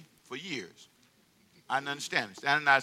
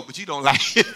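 ordinary talk

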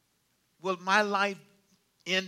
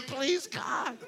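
mock desperate prayer; 4 semitones higher than usual. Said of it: "I've been praying".

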